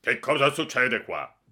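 loud, high angry demand; angry exclamation. Acted. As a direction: catch the boys in the act.

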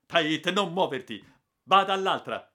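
very high, loud order; furious command shout. Acted. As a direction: yell at him not to move. Urgent command during tiger attack.

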